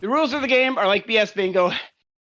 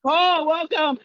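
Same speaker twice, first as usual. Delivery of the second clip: shouted welcome; 7 semitones higher, slower than usual. Real, not acted. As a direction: call out the welcome.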